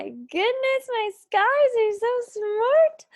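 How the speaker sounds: excited praise. Acted. Teacher praises the class.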